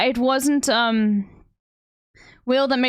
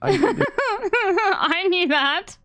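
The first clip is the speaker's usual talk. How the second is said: laughing; 8 semitones higher, much slower than usual. Real, not acted. Mocking a friend's claim.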